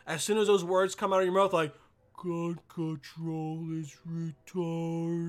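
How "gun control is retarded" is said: dragged out, very slow movie slow-mo; slow-motion movie voice. Retold or imitated. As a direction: stretch every word like a slowed film. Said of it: "it all becomes slow motion".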